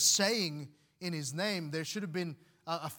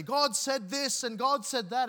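higher-pitched mock yell at a preacher; imitated yelling; 7 semitones higher, 4 dB louder than usual. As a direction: act out a heckler rebuking the preacher, pitch raised. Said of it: "started to yell at the preacher".

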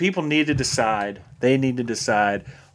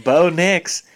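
slow, higher, sweetened Southern accent; sweet Southern accent; 4 semitones higher, 4 dB louder, slower than usual. Shown as a demonstration. Showing cohost how to sweeten it, Southern accent. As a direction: put Southern sweetness on the tip of the tongue. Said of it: "You gotta put a little sweetness on the".

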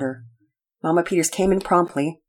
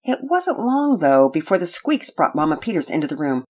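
ordinary delivery

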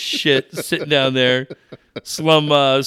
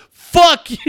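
high, loud mock yell from the scammer; mock shout; 12 semitones higher, 7 dB louder than usual. Scammer yells at the caller in a loud mock shout. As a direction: bark it like the angry scammer. Scammer Steve yelling, retold.

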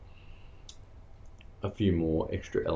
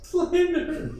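silly high voice; slower than usual. Acted. Playful echo in silly voice.

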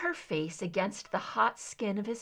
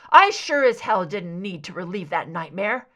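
angry; 2 semitones lower, 9 dB louder than usual. A narrator's reading. Mulder still angry.

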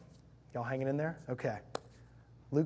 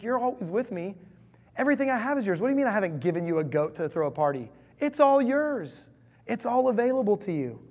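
much higher and louder, pleading joy; everything is yours, say it with arms wide open; eager father; 7 semitones higher, 7 dB louder than usual. Acted. Father urges resentful elder son, high excited pleading. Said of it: "So he's like".

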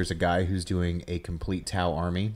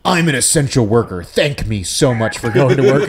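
mock self-important; 4 semitones higher, 9 dB louder than usual. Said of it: "It's now become like".

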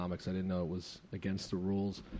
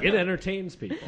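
higher, louder, laughing sarcasm; sarcastic tease; 10 semitones higher, 6 dB louder than usual. Acted. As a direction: rib her mockingly. Joining the teasing.

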